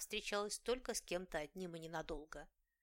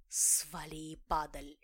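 slow, louder hissed threat; angry hiss; 3 semitones lower, 5 dB louder, slower than usual. Acted. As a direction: hiss it out slowly, menacing. Cat hisses threat again.

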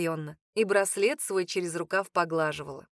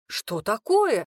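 louder and very slow, startled; gasp of surprise; 5 dB louder, much slower than usual. A narrator's reading. Cook startled as Emma storms out, gasping. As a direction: gasp it as the pot crashes. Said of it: "ахнул господин Дарбер".

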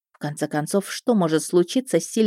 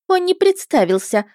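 put-on much higher girlish voice; dismissive mermaid; 13 semitones higher, 3 dB louder than usual. A narrator's reading. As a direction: brush the accusation off in a young girl's pitch. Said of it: "отмахнулась русалка". (русалка небрежно отмахивается)

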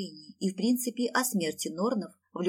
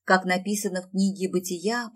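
usual speaking voice

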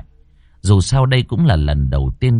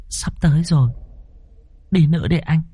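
put-on high girl's voice; giggling young woman; 7 semitones higher, faster than usual. A narrator's reading. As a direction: coax him onward in a high voice. Giggling girl urges him onward. (giọng cô gái cao, giục đi tiếp)

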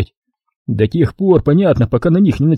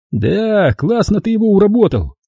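admiring; 7 semitones higher than usual. A narrator's reading. Guy in T-shirt praises Tolyan, admiring. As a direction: marvel at the boss's handiwork. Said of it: "восхищался парень в майке".

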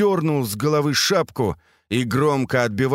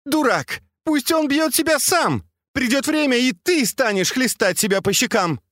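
very high-pitched angry tirade; losing temper; 13 semitones higher than usual. A narrator's reading. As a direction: rail at the servant, pitch way up. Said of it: "Фэнзе вышла из себя".

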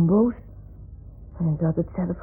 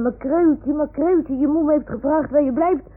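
higher, louder calls across the sea; raised, searching call; 6 semitones higher, 6 dB louder than usual. Acted. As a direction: call out for a lost little one. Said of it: "Dan liggen ze in de zee te roepen".

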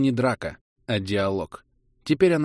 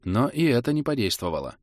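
plain reading voice

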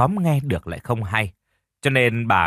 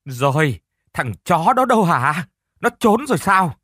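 roaring, much higher and louder; 6 semitones higher, 4 dB louder than usual. A narrator's reading. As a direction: bellow it, hands on hips. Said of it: "Bà chống nạnh gầm lên một tiếng".

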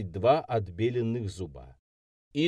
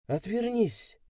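put-on high, strained girl's order; hoarse hiss; 11 semitones higher, slower than usual. A narrator's reading. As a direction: rasp it out, lightly. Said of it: "просипела она на меня".

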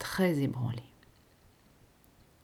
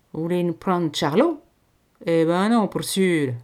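louder, blunt country voice; peasant refusal; 8 dB louder than usual. A narrator's reading. Peasant mother refusing to give up Charlot, blunt and loud. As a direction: slam the door on the offer.

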